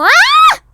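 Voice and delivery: very high, loud sudden scream; comic scream. Acted. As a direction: let out a shocked shriek. (صرخة مفاجئة عالية)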